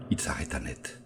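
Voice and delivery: narration chuchotée, plus grave; chuchoté. A narrator's reading. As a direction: souffler la phrase comme un suspense. (il s'arrêta net, chuchoté dans un souffle)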